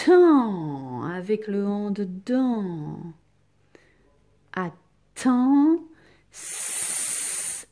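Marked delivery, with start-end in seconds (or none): 0.1-1.0 loud, long "temps" with a falling glide; isolated second syllable. Shown as a demonstration. Teacher correcting the second syllable, stretched out loud. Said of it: "Deuxième syllabe". 4.5-7.7 "Attends" in loud syllables, then a long hissed "S-"; sounding out. Shown as a demonstration. Teacher showing pupils where t makes s, sounded out. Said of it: "il fallait utiliser la lettre T".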